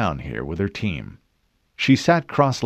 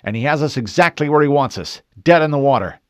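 louder, grim declaration; grim warning; 6 semitones higher, 6 dB louder, faster than usual. A narrator's reading. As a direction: press the danger home. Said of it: "Harrison stated".

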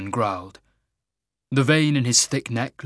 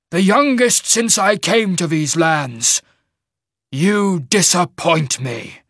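loud, raised voice with slow, heavy emphasis; booming, angry shaman; 7 semitones higher, 6 dB louder, slower than usual. Acted. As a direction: mage lord berating his underlings.

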